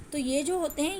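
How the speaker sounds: usual speaking voice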